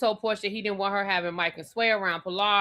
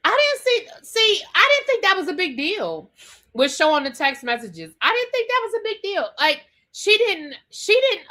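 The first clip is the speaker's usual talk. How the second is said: high, insistent disbelief; worked up; 10 semitones higher, 4 dB louder than usual. Real, not acted. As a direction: push back, rising in pitch. Worked up defending Shamea.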